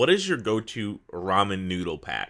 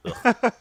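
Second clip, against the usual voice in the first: high loud ugh of disgust; disgusted groan; 13 semitones higher, 6 dB louder than usual. Real, not acted. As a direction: gag at the thought. Disgust at shrimp ramen.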